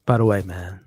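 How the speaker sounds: ordinary talk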